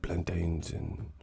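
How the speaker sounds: put-on slow, hushed accented half-whisper; mock soft-spoken boxer, low and halting; Miguel Cotto whispering. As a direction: murmur it in fragments with long pauses. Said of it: "what the fuck are you whispering".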